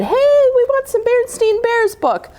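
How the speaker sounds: put-on high, childlike voice; squeaky kid voice begging for a book; kids asking. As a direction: pipe up like an eager child. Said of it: "you kids re-, said".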